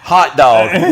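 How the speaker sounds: put-on loud childish answer; mock kid. Kid answers the parent, stubborn childish blurt.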